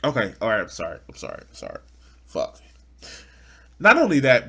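normal talking voice

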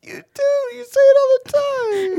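high, loud, wailing sob; sobbing accusation. Acted. Kale sobs out his accusation at Rick. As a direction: wail it through the tears like a hurt child.

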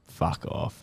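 a breathless, whispered "fuck off"; whispered disbelief. Retold or imitated. Told he's in the Origin squad, whispered disbelief. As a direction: hush it out like you can't believe it. Stunned reply to Origin call-up.

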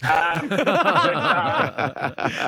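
chuckling through the words; laughing. Real, not acted. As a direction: laugh while trying to go on. Caller laughs mid-sentence.

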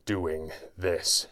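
slow, broken phrases with long gaps; halting words. A narrator's reading. Wounded hero struggles to speak to his enemy, halting. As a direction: force out each word through pain. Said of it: "a few halting words escaped his lips".

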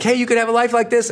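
high, fast, bright cheer on "Hey, you could have a life like this.". Shown as a demonstration. Coaching course creators, she cheers the buyer on excitedly. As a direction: hype them up like a coach. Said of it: "You have to cheer people on".

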